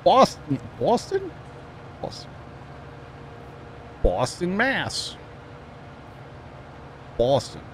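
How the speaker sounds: broad Boston vowels, pitch jumping about; mock Boston accent. Teasing Sean who lives outside Boston, Boston accent. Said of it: "when you live just outside of Bos".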